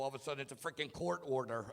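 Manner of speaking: normal talking voice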